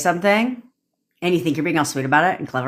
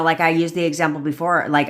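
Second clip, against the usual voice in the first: normal talking voice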